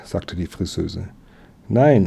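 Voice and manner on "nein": louder, higher exclaimed refusal; calling out. A narrator's reading. Boy protesting to his uncle, calling out. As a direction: call it out in protest. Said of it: "rief Emil".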